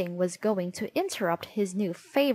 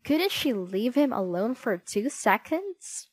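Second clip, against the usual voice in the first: usual speaking voice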